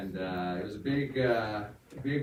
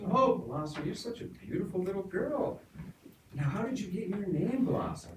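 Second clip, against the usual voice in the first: much higher, sugary preacher; gushing preacher; 11 semitones higher than usual. Pretend. Preacher cooing over the girl's name, high and sweet. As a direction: coo at the little girl. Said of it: "He goes".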